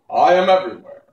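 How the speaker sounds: loud, grand voice; booming Oz. Acted. Oz answers Dorothy, loud and grand. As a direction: boom it like a god.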